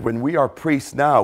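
normal talking voice

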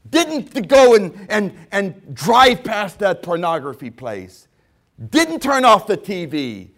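loud, high wailing regret; anguished shout. Retold or imitated. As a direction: cry out in bitter regret. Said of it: "and you're said".

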